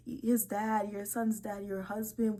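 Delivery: usual speaking voice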